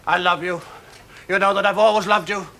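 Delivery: loud, high declaration of love; passionate outburst. Acted. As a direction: pour out his feelings loudly.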